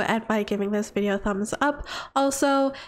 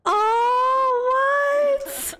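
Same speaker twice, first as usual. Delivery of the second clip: much higher, squealing with surprise; delighted squeal; 9 semitones higher, much slower than usual. Real, not acted. Streamer seeing the couple's cute interaction, squealing much higher. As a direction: gush over something adorable.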